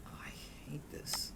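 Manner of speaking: slow, low whisper to oneself; whispered aside. Acted. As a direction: whisper it under your breath, slowly. Muttered aside during awkward silence.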